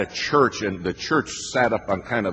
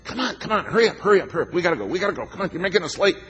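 high squawking hurry-up; hammed-up henpecking squawk; mock squawking; 10 semitones higher, much faster than usual. Husband squawks at his wife up the hill, high and frantic. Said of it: "I squawk at her".

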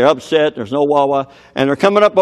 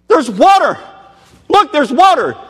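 much higher, louder joyful cry; excited shout; 13 semitones higher, 6 dB louder than usual. Acted. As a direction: yell it like you just found water after three days. Israelites spotting water.

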